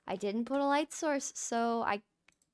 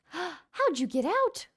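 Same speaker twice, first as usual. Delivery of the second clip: surprised; 7 semitones higher, 4 dB louder, slower than usual. Real, not acted. Finds snow golem loose.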